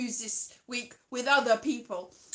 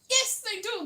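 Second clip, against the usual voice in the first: much higher, insistent; indignant retort; 10 semitones higher than usual. Real, not acted. Vlogger rebutting Americans' claims, indignant. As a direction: snap back in protest.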